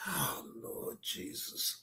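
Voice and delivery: slow whisper of exasperation; cringing whisper. Real, not acted. Reactor cringing at Tommy, slow exasperated whisper. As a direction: sigh it out on breath.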